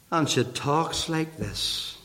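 usual speaking voice

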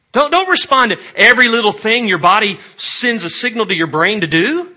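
loud, high, insistent exhortation; forceful preaching. Real, not acted. Preacher urging congregation to master the body, loud and insistent. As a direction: urge it loudly, punching the phrases.

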